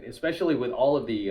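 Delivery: ordinary delivery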